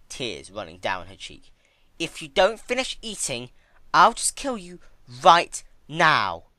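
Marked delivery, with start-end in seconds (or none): 2.0-6.4 slow, high and loud icy verdict; cold Twilight. Acted. Twilight informing the crying princess, icy and loud. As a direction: deny her flatly, from above.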